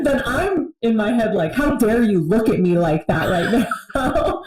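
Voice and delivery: laughing while quoting her own angry thought. Retold or imitated. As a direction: laugh through the indignant line. Retelling fury at husband, laughing.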